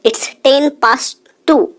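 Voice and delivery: slow teacher demo; very slow model phrase. Shown as a demonstration. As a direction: stretch every word for learners. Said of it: "teacher models English time".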